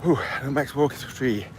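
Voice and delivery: puffing from the uphill walk; out of breath, panting between words. Real, not acted. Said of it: "Out of breath, makes I'm walking uphill".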